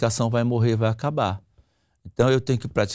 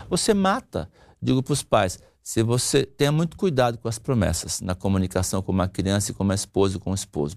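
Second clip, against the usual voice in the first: usual speaking voice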